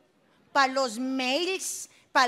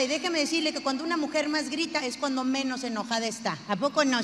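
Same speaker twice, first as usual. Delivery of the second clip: usual speaking voice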